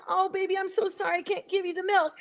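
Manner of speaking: put-on high pleading mother and baby cow voices; voicing the cows. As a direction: plead like a cow calling for her calf. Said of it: "the mothers are over there going".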